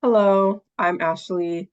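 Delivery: ordinary talk, nothing marked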